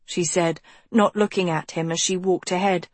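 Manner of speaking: plain reading voice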